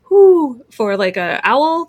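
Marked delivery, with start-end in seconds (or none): 0.1-0.6 owl whoo. Shown as a demonstration. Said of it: "instead of a".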